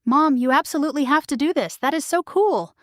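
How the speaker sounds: bright, high, eager praise; excited daughter. Acted. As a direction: gush with sudden excitement. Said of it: "Maya's enthusiasm was instant and overwhelming".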